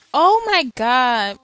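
real loud exasperated outburst. Real, not acted. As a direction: cry out slowly in dismay at the game. Reacting to game going wrong.

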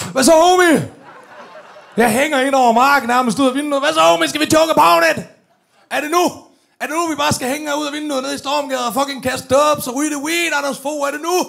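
high, loud cocky shout; cocky hollering. Retold or imitated. Hanging out the car window, hollering cocky invitations. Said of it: "han kigger op".

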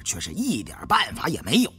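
ordinary delivery

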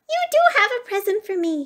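high, fast, delighted mouse squeal; excited cry. A narrator's reading. Mouse spots the stockings, high excited cry. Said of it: "he cried".

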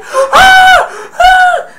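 piercing high, very loud shriek; shrieking scream. Retold or imitated. Marv finding a tarantula on him, shrieking. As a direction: screech in panic at a spider. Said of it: "he's like".

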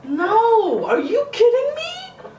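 high, loud, laughing-crying panic; panicked shriek. Real, not acted. Refusing to climb down into the cellar, panicked shriek. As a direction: shriek it, near tears with nervous laughter. Told to go down ladder.

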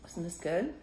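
ordinary delivery

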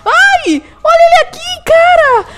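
high, loud excited cry; excited shout. Real, not acted. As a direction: yell out in sudden joy. Spots the pet suddenly.

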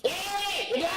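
high-pitched call from a distance; calling over. Acted. Calls the child back again.

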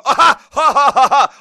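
big loud burst of laughter. Real, not acted. Host laughing at Republicans choosing Trump, loud laugh.

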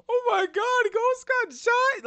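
high, loud, hammed-up alarm; mock concern. Host voicing Tasha's look at the shooting, mock alarm. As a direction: cry it out in exaggerated shock. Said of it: "Look at that concerned look on her face".

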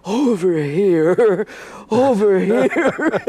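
slow, high dazed calling; dazed associate. Retold or imitated. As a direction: call out haltingly, stunned. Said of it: "his associate is going".

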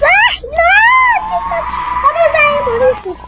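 high, wailing game panic; hammed-up doomed cry; mock terror. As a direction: wail in playful alarm, pitch way up. Pretend terror at dying in game.

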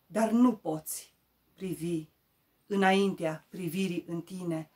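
recitare de poezie. Acted. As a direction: declaim the verse, strong openings settling at line ends. Recites her poem. (poezie declamată, rostită apăsat)

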